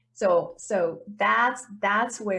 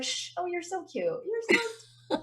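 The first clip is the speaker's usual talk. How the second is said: cooing to a dog; 7 semitones higher, 5 dB quieter than usual. Pretend. Coach talking to her inner voice as a dog, soft coo. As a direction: coo at the little voice like a puppy. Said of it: "you're like".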